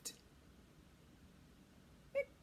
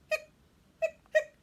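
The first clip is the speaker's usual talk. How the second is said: hiccup sounds; 13 dB louder than usual. Acted. Skeleton hiccuping.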